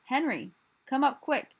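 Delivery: high, loud call for help; mother yelling. A narrator's reading. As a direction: yell urgently for someone to come. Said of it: "And she yells".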